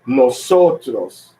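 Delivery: "nosotros" drawn out, leaning on SO; slow, deliberate noSOtros; Spanish pronunciation. Shown as a demonstration. Teacher showing a Spanish word, stressed pronunciation. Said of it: "When we speak in Spanish".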